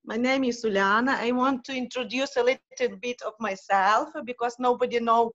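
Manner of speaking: non-native English with a Ukrainian accent; hesitant Ukrainian-accented English. Real, not acted. Uh, I'm from Ukraine.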